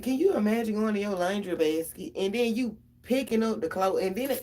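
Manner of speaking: ordinary talk, nothing marked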